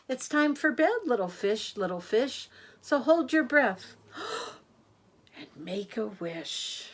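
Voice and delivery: sing-song rhyming read, lifting on each line; bedtime rhyme. A narrator's reading. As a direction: lilt the rhyme to little ones. Reads bedtime rhyme to children.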